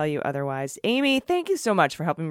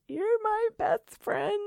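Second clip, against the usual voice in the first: slow, high, tender falsetto; affectionate; much slower than usual. Real, not acted. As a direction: sing it sweetly to a friend. Affectionate sign-off to cohost.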